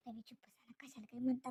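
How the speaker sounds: hushed, voiceless plea to be quiet; urgent whisper. Acted. Wife urgently hushes her husband mid-quarrel, whispering. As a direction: whisper so nobody else hears.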